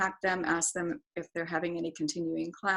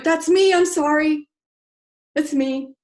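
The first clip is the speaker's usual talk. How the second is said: much higher and louder apologetic outburst; sheepish confession; 9 semitones higher, 11 dB louder than usual. Retold or imitated. Apologizing to the class for upsetting the water, loud and sheepish. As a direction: own up fast, embarrassed. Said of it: "And I went".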